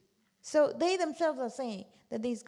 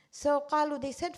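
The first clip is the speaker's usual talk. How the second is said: ordinary delivery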